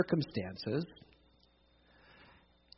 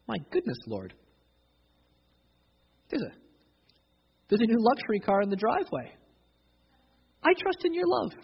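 mock gleeful; 7 semitones higher than usual. Mock happy prayer over luxury car.

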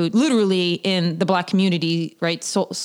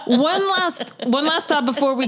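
high squawky cartoon duck voice; quacky cartoon duck impression, laughing; Donald Duck voice; 7 semitones higher than usual. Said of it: "Say Pepe Le Pooch in your Donald".

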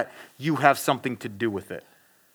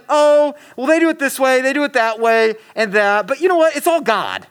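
higher-pitched mimicry of a casual believer; lightly mocking, pitched up; breezy mock dismissal; 7 semitones higher, 4 dB louder than usual. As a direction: wave it all away in a raised voice. Acting out the all-religions-are-one mindset.

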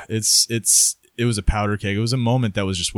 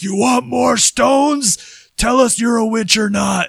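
much higher, louder, slow taunting roar; hammed-up jailer yelling a slow taunt; mock interrogator; 14 semitones higher, 5 dB louder, slower than usual. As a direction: bellow it down at him like a torturer piling on stones. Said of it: "The guy's like".

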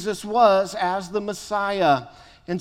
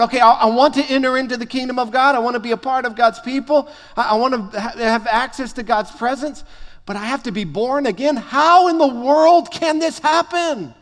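fast, higher, louder baffled rambling; puzzled thinking aloud; 3 semitones higher, 4 dB louder, faster than usual. Retold or imitated. Nicodemus baffled by Jesus, thinking aloud fast and flustered. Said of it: "He's kind of talking out loud and processing out loud".

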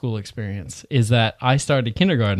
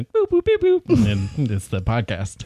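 vocal beep-boop noises. Retold or imitated. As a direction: chirp the beeps. Imitating intercom beeps.

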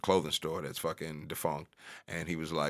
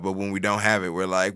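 usual speaking voice

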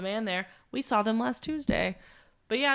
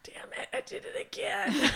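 real whispered self-reproach; whispered aside; 10 semitones higher, 6 dB quieter than usual. Real, not acted. Storyteller catches a slip mid-story, whispered aside. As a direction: whisper it to yourself after a slip.

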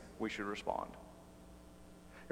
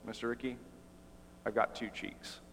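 much softer, calm, steady reply; quiet Jackie; 3 semitones lower, 12 dB quieter than usual. Acted. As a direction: deliver the punchline softly and calmly.

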